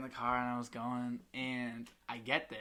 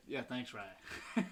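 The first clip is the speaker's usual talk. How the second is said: slow awkward thanks; 5 dB quieter, much slower than usual. Retold or imitated. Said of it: "I was like".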